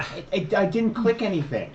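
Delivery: high, loud stammered denial; indignant, stuttering self-defense; real protest. Real, not acted. Denying the game master's accusation.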